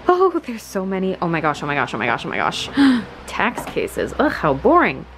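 usual speaking voice

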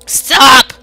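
loud frightened shout. Real, not acted. Monster again.

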